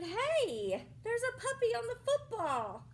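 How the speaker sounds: put-on mildly shouted kid's warning; girl shouting. A narrator's reading. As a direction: call out like an excited little girl. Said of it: "one girl shouted".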